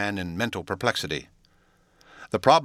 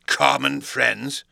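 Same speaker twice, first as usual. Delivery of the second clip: slow, hoarse, higher and louder echo; 5 semitones higher, 5 dB louder, much slower than usual. A narrator's reading. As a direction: drag it out hoarsely in disbelief. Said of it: "echoed Mister Hyde a little hoarsely".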